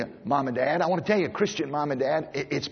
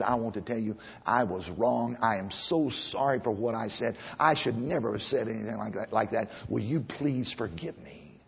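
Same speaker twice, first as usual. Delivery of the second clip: tender apology; 7 semitones lower, 7 dB quieter than usual. Retold or imitated. Father apologizing to his children.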